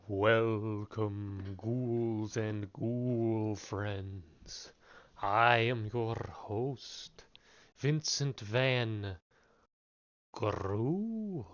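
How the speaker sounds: slow, booming spooky host voice; loud creepy horror-host welcome. Acted. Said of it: "See, it's completely different to Vincent Van Ghoul".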